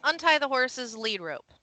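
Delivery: ordinary delivery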